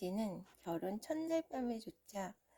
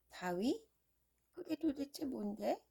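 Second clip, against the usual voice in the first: baffled question, pitch up; puzzled prince; 4 semitones higher than usual. Acted. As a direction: ask in confusion.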